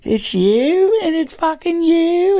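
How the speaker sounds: put-on very high, drawn-out drunken whine; drunk heckler. Drunk heckler pointing out the comedians, high and slurred. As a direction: imitate a drunk squealing in recognition. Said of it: "he's all fucking drunk".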